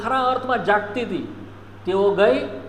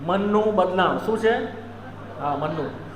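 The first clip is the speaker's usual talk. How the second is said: ordinary delivery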